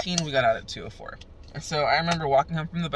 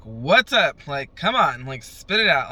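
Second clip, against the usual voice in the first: louder, pushy demand; impatient urging; 8 dB louder than usual. Retold or imitated. To her mom delaying bad news, loud and impatient. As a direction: push her to spit it out.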